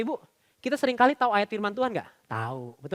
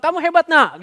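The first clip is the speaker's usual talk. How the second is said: higher, louder dad's praise; praising father; 6 semitones higher, 6 dB louder than usual. Acted. Father coming home to his child, loud high praise. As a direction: cheer the child on loudly. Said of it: "Papanya pulang".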